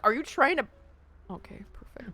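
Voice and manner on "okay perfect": whispered, soft and breathy aside; whispering. Real, not acted. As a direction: whisper it under your breath.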